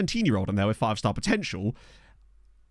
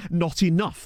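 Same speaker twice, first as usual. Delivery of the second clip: usual speaking voice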